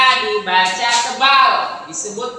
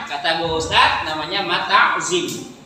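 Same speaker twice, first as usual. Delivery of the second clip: speaking much lower and softer; very soft aside; 8 semitones lower, 7 dB quieter than usual. Real, not acted. Teacher quietly explaining to nearby students. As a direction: drop to a hushed low murmur.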